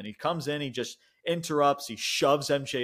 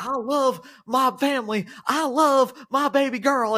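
high, loud mocking whine, slowed; hammed-up impression of a doting dad; mocking Dax; 13 semitones higher, 6 dB louder than usual. Retelling MJF mocking Dax, high mocking whine. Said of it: "mocking freaking Dax".

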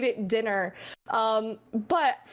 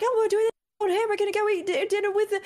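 much higher, eager friend voice; imitating Jebro; 11 semitones higher, faster than usual. To Jeb, mimicking his excited invitation at the event, high and eager. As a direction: bounce it up high like an excited buddy. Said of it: "this is exactly how you sound".

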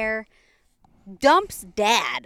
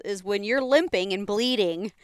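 ordinary delivery

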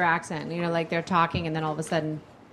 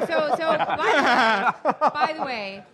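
talking through real laughter; laughing; 5 semitones higher, slower than usual. Real, not acted. Cohost laughing at the drop while changing the subject. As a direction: laugh your way into the next topic.

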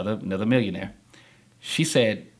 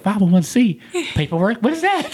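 high, incredulous questioning, laugh creeping in; baffled echo; 10 semitones higher, 4 dB louder than usual. Retold or imitated. Newcomer asked for nonprofit paperwork, baffled and high-pitched. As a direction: echo it as if utterly lost.

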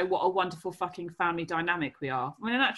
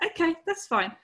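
reverse psychology; 9 semitones higher than usual. Retold or imitated. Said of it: "the whole reverse psychology thing".

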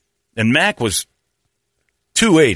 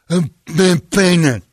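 put-on high, loud, slow kid voice; hammed-up deaf kid; 8 semitones higher, 4 dB louder, slower than usual. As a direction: say it like the half-deaf boy. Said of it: "he was a little deaf".

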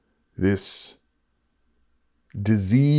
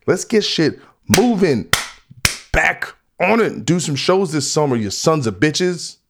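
heated yell; 6 semitones higher than usual. Real, not acted. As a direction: push it out like a rallying yell. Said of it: "I didn't mean to yell".

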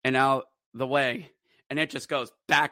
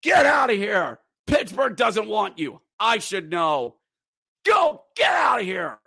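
yelling; 6 semitones higher, 3 dB louder than usual. Retold or imitated. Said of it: "And I am yelling".

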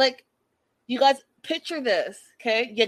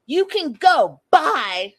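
put-on a light childish pout, louder and higher; 6 semitones higher, 7 dB louder, slower than usual. Imitating the woman dismissing her date, mildly childish pout. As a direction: toss off "You can go. Bye" like a sulky kid. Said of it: "like a child".

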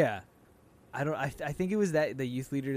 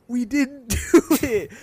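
high, loud, slow, laughing; mock protest; 9 semitones higher, 11 dB louder, slower than usual. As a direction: plead innocence with a laugh. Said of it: "And I'm like".

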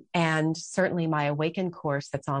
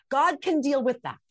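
ordinary talk, nothing marked

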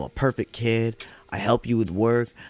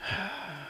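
resigned sigh; 10 semitones higher, 11 dB quieter than usual. Retold or imitated. Friend about to confess.

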